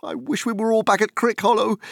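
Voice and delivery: high, fast, desperate wail; frantic outburst. Acted. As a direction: rush it out in panic. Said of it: "cried Frodo wildly".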